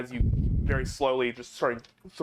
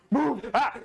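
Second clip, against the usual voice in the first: mocking shout; 5 dB louder than usual. Crowd mocks Goliath's shout.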